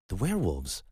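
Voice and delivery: as if he can't believe the word; startled disbelief. Acted. Matteo balks at Gus's werewolf answer, incredulous.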